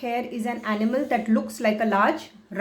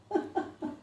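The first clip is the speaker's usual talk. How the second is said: cat's laugh; 7 semitones higher, 10 dB quieter than usual. Acted. Teacher laughs as the mad cat.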